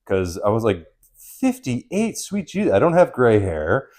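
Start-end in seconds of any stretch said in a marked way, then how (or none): 1.2-3.9 much higher, stunned Fifty-eight; reliving the shock of the guess, much higher; appalled. Retold or imitated. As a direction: gasp it out appalled. Repeating insult aghast.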